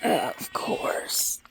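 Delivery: soft unvoiced whisper of course. Real, not acted. To herself about the goat, a whisper. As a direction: breathe it to yourself, no voice.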